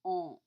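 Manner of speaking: isolated nasal "On" said alone, loud; the French nasal on in "On". Shown as a demonstration. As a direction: hold the nasal vowel of "On" with no N. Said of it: "comment has a nasal sound at the end".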